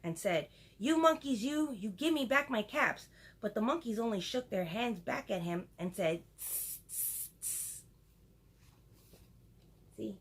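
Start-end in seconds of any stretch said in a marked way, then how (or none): 0.8-3.1 high, indignant storybook scolding; angry scolding. A narrator's reading. Peddler shaking both hands at monkeys, angry scolding. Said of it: "This made the peddler angry". 6.4-7.8 three short soft "tss" sounds; mocking monkey hiss. Monkeys mimicking the peddler from the tree, hissing.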